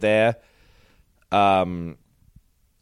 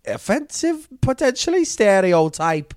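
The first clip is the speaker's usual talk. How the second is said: higher, louder mock Scouse accent; Liverpool-ish accent; 5 dB louder, slower than usual. [name] griping about her dad, mock Liverpool accent. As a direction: ham up a northern English girl. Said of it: "a fucking Liverpool bullshit thing".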